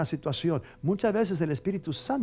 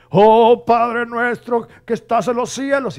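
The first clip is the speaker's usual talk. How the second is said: much higher and louder, slow quavering prayer; overdone tearful Our Father; dramatic trembling prayer; 8 semitones higher, 11 dB louder, slower than usual. Retold or imitated. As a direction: ham up the prayer like a weeping preacher. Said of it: "le puso con más jugo, hasta temblaba".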